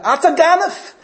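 furious high-pitched outcry; screaming accusation. Retold or imitated. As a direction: yell across the room that he stole it. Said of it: "screams out".

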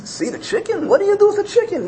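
put-on high-pitched girlish disbelief; incredulous. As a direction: question him in a high, baffled voice. Girl's startled reply, retold.

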